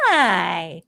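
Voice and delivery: drawn-out Southern hi; grandson's Southern accent. Retold or imitated. Grandmother imitating grandson's hi, long Southern drawl. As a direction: stretch hi like a Southern child. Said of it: "my grandson's getting a Southern accent".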